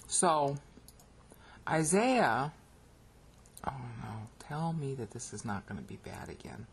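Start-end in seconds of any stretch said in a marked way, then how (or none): 3.7-6.7 quiet frustrated aside. Real, not acted. Computer lagging, frustrated aside.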